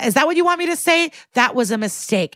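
high, exasperated mock confession; testy admission. Witness snaps at the lawyer under questioning, mock-exasperated admission.

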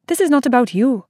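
doctor overriding the mother, firm and insistent. A narrator's reading. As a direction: shut the mother down firmly. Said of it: "voice was insistent".

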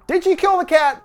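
high-pitched suspicious question; mock-incredulous quoted question; lightly acted accusation. A narrator's reading. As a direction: voice his loaded question, pitched well up.

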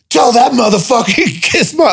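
high, laughing fake fury; half-joking threat to the bosses; hammed-up rage breaking into a laugh; mock telling-off. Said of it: "call New York and go".

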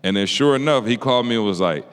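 ordinary talk

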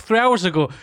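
ordinary talk

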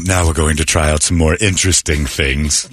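a hint of a low instructional voice; video narrator. Host mocking the pronunciation video's narrator, low voice. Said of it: "understand a guy going".

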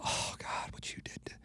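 unvoiced whisper in the courtroom; hushed aside, barely breathed. Whispering to his attorney in court, hushed.

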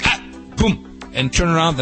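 ordinary delivery

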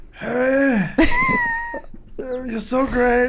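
high, soft mock old wizard fawning over Harry; Dumbledore impression. Mocking Dumbledore praising Harry, high soft put-on voice. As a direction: gush like a doting old headmaster. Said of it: "Dumbledore in season three is like".

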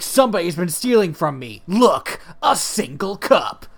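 loud, declamatory mock outrage; mock accusation. Host playing Joseph accusing his brothers, loud mock indignation. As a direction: announce the theft with hammed-up drama.

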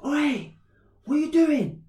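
high, loud challenging yell; re-enacted shout to stop someone; confrontational call-out. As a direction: call him out sharply from a distance. Retold confrontation, calling out to man.

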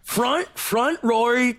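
mock Australian. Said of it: "our impression of an Australian".

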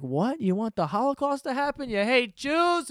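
high-pitched incredulous accusation; hammed-up scandalized critic; mock outrage. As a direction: imitate an appalled accuser, stretching the last question. Voices outraged critic of opposite answer.

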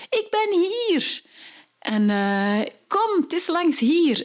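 high, slow, gentle call; mother calling. Acted. Mother calls her lost daughter through the woods, high and slow. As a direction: call the child towards you, high and slow. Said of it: "de mama die zegt".

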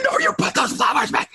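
high mock yell; half-hearted yell of a parent who knows he's overreacting; yelling at son. Dad yelling at his son over video game flowers, mock shout. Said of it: "I'm yelling at my son".

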